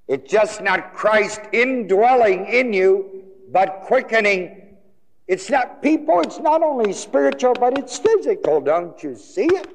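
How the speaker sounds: high, urgent pulpit exhortation; preacher pleading at full force; impassioned preaching. Real, not acted. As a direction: press the point home, pitched right up. Preacher drives the point home.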